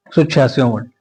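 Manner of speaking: ordinary talk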